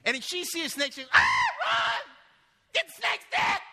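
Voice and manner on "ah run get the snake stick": very high, loud mock shriek; panicked scream. Grandmother spotting a snake, imitated panicked shouting. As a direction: scream the alarm like a frantic grandmother.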